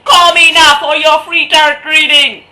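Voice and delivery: loud, high, slow bad Jamaican accent; hammed-up Jamaican psychic ad. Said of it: "That was really bad Jamaican accent".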